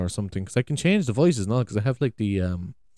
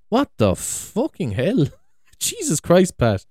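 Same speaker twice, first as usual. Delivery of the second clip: louder, higher, slowed in shock; appalled disbelief; 5 semitones higher, 7 dB louder, slower than usual. Real, not acted. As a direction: stretch it out in horrified disbelief. Shocked reaction to clip.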